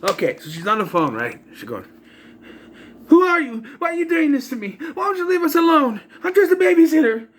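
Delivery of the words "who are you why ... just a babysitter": mock babysitter in high-pitched overacting; badly acted plea, pushed way up high; terrible acting. Babysitter pleading with her phone stalker, terribly acted. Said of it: "She's terrible".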